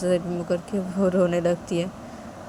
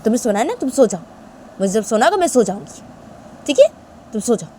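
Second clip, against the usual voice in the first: cross Tisha; 6 dB louder than usual. Acted. Tisha refuses to hug Shanaya, crossly. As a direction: refuse firmly, still sulking.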